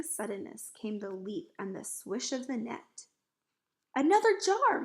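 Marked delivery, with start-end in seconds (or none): 3.9-4.8 high, loud call; urgent cry. A narrator's reading. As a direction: shout for it urgently. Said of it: "he cried".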